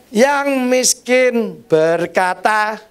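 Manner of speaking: slow preaching. Acted. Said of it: "preacher drawn-out phrase".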